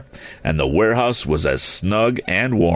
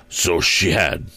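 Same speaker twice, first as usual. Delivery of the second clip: ordinary delivery, nothing marked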